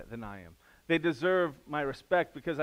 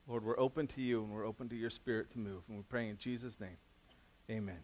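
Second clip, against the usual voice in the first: soft, hushed prayer to God; gentle pastor's prayer, much softer than the sermon; 4 semitones lower, 6 dB quieter than usual. Acted. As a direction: pray quietly and humbly over the room. Leads the congregation in prayer.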